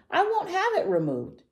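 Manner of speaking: very high, shrieky refusal; screamed protest. A narrator's reading. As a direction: protest like a tantrumming little dinosaur. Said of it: "screamed Tiny".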